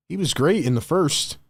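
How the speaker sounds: ordinary talk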